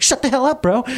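high-pitched mock exasperation; hammed-up incredulous retort; Jesus' exasperated retort. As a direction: blurt a comic put-down, half laughing. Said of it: "even Jesus would be like".

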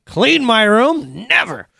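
put-on high, drawn-out childish defiance; little kid refusing. Preacher mimics a kid refusing chores, high defiant whine. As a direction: refuse like a stubborn small child. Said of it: "like a little kid who doesn't wanna".

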